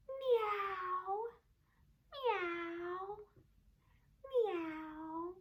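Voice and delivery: high soft meows; cat meowing. Shown as a demonstration. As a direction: mew like a kitten. Imitating cat.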